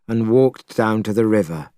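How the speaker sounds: plain reading voice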